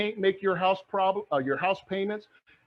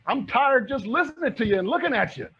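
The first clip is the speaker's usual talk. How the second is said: Stacy hyped; 5 semitones higher, 5 dB louder than usual. Retold or imitated. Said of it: "Stacy's like".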